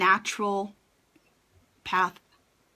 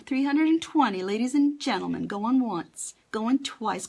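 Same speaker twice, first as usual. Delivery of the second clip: usual speaking voice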